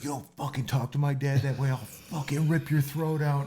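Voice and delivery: a hint of a raging teen defending his dad; angry son. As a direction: son telling the drunk guest off, mildly enraged. Son threatens the drunk guest.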